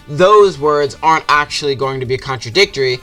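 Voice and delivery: usual speaking voice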